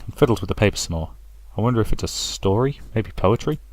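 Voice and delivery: usual speaking voice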